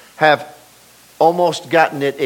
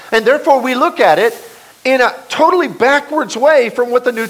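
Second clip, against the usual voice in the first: higher, louder pulpit preaching; full-force preaching; 8 semitones higher, 4 dB louder than usual. Acted. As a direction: drive it home from the pulpit.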